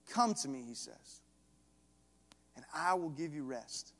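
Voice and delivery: soft, quiet invitation; gentle call. Acted. Jesus gently inviting the tired listener, soft and quiet. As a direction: draw them in tenderly.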